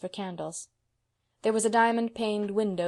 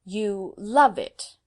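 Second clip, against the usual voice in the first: slow and weighty, leaning hard on love; with emphasis; 2 semitones higher, 8 dB louder, much slower than usual. A narrator's reading. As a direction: stress love, drawn out and louder. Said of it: "with emphasis".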